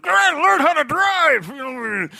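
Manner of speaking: very loud, high, drawn-out mock yell; growl-shout it slowly at another driver; hammed-up road-rage grumble. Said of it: "I kinda grumble in the car".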